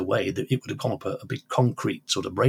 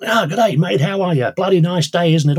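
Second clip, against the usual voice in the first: loud, high Australian bloke banter; a hearty Australian greeting, pitched up and loud; Norman's Australian accent; 8 semitones higher, 7 dB louder than usual. Retold or imitated. Teller voicing the chatty Australian chap greeting the old man in a loud, high Australian accent. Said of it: "a bit of a loquacious Australian chap called Norman".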